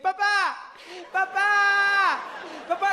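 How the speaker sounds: put-on a frozen little boy calling his dad; shrill scared child calling out; terrified kid. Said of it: "he's like".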